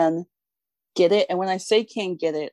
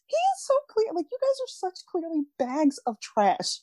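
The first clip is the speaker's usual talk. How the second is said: much higher put-on voice, mocking the family; a squeaky high voice scorning the family as trash; mocking falsetto; 13 semitones higher than usual.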